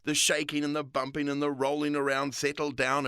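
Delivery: usual speaking voice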